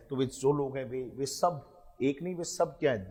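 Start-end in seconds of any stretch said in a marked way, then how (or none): none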